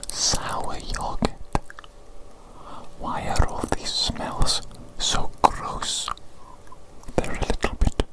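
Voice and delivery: usual speaking voice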